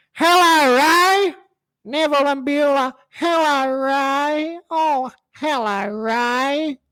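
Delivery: very high, creepy, sing-song greeting; Neville and Beulah. Retold or imitated. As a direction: coo it like a sleazy swinger couple. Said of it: "Neville and Beulah were these two, this creepy swinger couple".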